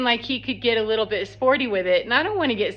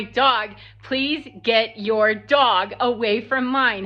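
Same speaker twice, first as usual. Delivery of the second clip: slow, measured retold plea to a stranger; firm slow request; slower than usual. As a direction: spell it out slowly, word by word. Retells firm request to woman.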